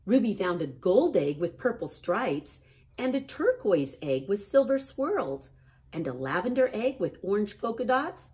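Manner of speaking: ordinary delivery, nothing marked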